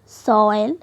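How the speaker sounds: सॉइल stretched on one gliding oy vowel; joined glide. Shown as a demonstration. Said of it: "ये दोनों एक ही जुड़ा हुआ है". (सॉइल में ऑय को जोड़कर खींचें)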